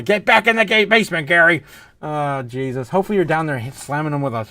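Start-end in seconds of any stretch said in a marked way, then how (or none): 0.0-1.6 loud, high mock yell; play-scolding shout; mock order. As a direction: holler a joking command at someone. Mock order yelled at Gary.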